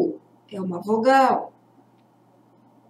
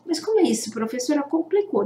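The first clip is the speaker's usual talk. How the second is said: higher, softer pupil's voice; confused pupil; 4 semitones higher, 4 dB quieter, much faster than usual. Acted. Pupil complaining to the teacher that it got complicated, confused pupil. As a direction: pipe up softly as a puzzled student.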